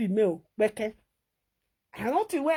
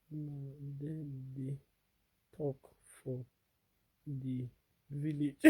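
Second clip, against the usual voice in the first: much lower and softer, slow; hushed, much lower and softer; 7 semitones lower, 13 dB quieter, slower than usual. Real, not acted. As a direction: murmur it low and slow like a tired aside. Lower, much quieter held voice.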